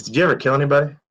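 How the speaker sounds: a hint of a naive eighteen-year-old; imitating the kid. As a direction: play the nosy kid asking bluntly.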